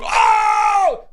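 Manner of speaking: mock crowd roar; crowd's big "oh". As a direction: shout it like a stunned arena crowd. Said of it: "the whole crowd's going".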